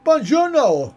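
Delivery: high, loud mock Italian greeting; hammed-up Italian like a cartoon plumber; playful Mario-style buongiorno; Italian accent. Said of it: "I can do Italian as well".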